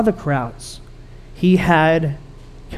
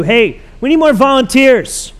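louder and higher, a hammed-up call for helpers; mock church announcement; 6 semitones higher, 6 dB louder than usual. Pastor teasing his own upcoming appeal, loud and mock-eager. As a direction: play the eager pastor begging for volunteers. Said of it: "I'm gonna say that in a few minutes".

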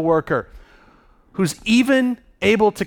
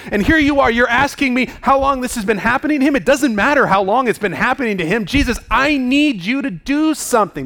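higher-pitched, incredulous father pleading; exasperated father; 5 semitones higher than usual. Acted. A father confronting Jesus over his stricken son, exasperated. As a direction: demand action, in disbelief at the question. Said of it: "if I'm the father in this story".

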